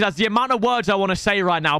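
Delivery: ordinary delivery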